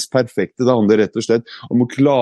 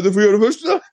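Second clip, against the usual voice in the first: teasing dare; 10 semitones higher, faster than usual. Retold or imitated. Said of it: "Så jeg sa".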